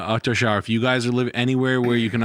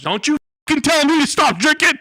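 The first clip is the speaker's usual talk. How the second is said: put-on furious drunken yell, much higher and louder; belligerent drunk; 7 dB louder than usual. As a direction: scream it like an angry drunk. Acted drunk quarrel about drinking.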